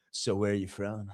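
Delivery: low, soft, sleazy murmur of a question; creepy Mike voice. Retold or imitated. Imitating Mike asking the athlete where she's from, low and soft. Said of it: "sounded like he was fucking".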